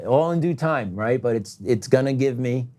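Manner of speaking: ordinary talk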